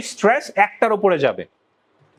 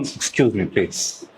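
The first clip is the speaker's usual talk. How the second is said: low, falling "excuse me, please"; understated, low-pitched plea; polite request; 14 semitones lower, 4 dB quieter, much slower than usual. Shown as a demonstration. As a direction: ask softly and low, letting it drop away. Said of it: "টোনের ডিফ-, different পাচ্ছেন?".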